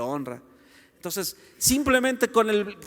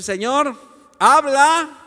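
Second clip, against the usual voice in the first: loud, raised-pitch appeal; emphatic prayerful summons; calling on God; 6 semitones higher, 9 dB louder, slower than usual. Acted. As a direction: call out boldly to God. Models calling on God to speak.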